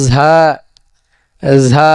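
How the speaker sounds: ঝা as a buzzed "zaa" without much pressure; unpressed Arabic za. Shown as a demonstration. Teacher demonstrates ঝা without pressing hard, lightly buzzed. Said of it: "বেশি চাপ দেওয়া যাবে না".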